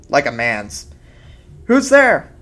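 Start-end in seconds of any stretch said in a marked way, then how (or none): 1.6-2.3 high, loud frightened call. A narrator's reading. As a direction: call out into the dark house. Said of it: "she called".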